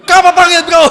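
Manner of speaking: sudden loud, high burst; hammed-up indignant dad yell; outraged father. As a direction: explode in mock outrage at the child. Voices shocked father called bro.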